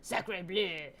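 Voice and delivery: lightly voiced French detective; French accent. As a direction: say it like a French sleuth. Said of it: "he's like / make him French".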